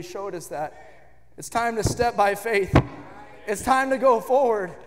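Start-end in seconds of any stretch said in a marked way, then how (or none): 3.5-4.7 loud, high preaching shout; full-force preaching. Acted. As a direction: lift the room, proclaiming God above all. Closing appeal builds.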